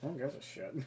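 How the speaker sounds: quiet, dismissive shrug of a voice; mock nonchalant. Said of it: "I was all nonchalant".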